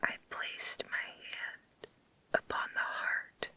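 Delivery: ordinary delivery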